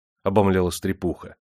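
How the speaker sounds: usual speaking voice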